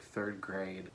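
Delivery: usual speaking voice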